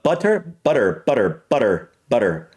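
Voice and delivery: "butter" with flapped T and full R; American pronunciation. Shown as a demonstration. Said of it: "For me, it would be butter".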